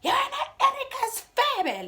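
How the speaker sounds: high, slow yell of a name with a laugh; a high, stretched call of her name. Retold or imitated. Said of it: "yells my name".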